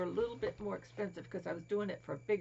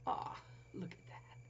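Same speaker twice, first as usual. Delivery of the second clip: aw whispered softly; delighted hush; 3 semitones lower, 8 dB quieter than usual. Real, not acted. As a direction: coo it in a whisper. Whispering over toy bunny.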